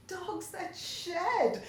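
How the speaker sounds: high, loud and slow gleeful cry; gleeful shriek, high and loud; furious glee. Acted. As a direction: pile on with wicked delight. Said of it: "Bea joined in with furious glee".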